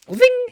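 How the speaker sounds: very high, loud mock whizz; imitated zipping noise. Showing how easily it's faked, loud high zip. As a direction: squeal out the pointer's swift slide.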